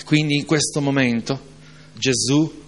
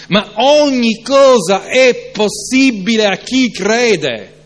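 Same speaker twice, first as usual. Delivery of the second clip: "Ma ogni cosa è possibile a chi crede" proclaimed slow, loud and high; fervent slow proclamation; proclaimed verse; 9 semitones higher, 7 dB louder, slower than usual. Acted. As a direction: ring out every word of the promise. Proclaims the verse, later repeated softly.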